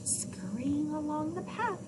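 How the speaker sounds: plain reading voice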